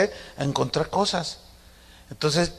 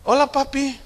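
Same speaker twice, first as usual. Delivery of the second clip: daughter greeting; 9 semitones higher than usual. Retold or imitated. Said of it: "fíjense cómo es ahora".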